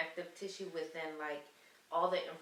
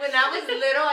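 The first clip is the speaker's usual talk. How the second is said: laughing; 6 semitones higher, 15 dB louder, faster than usual. Real, not acted. Starts childhood story laughing.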